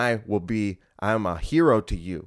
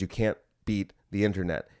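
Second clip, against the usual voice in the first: usual speaking voice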